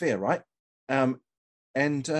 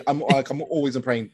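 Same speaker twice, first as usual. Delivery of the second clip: usual speaking voice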